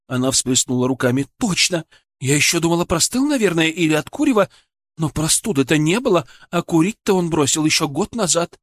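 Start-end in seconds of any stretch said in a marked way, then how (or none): 1.4-8.6 high, eager woman's voice; excited patient's wife. Acted. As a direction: pour out the recollection, pitched up. (взволнованная жена, высоким голосом)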